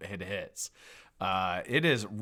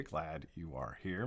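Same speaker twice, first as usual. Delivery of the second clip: usual speaking voice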